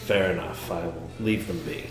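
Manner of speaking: ordinary talk, nothing marked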